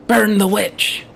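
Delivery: shouting like a zealot mob leader; angry mob leader. Pretend. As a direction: rouse the crowd, loud and fierce.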